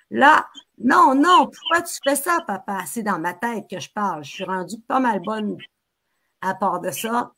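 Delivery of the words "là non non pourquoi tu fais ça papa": inner cry. Acted. Child cries out in her head.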